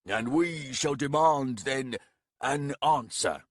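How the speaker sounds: booming proclamation. Acted. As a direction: proclaim it with arms wide. Baragast declaims, arms raised.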